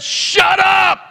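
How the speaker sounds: high, loud yell; commanding shout. Retold or imitated. Preacher cutting off the woman's prophecy, shouting. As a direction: cut her off with a full yell.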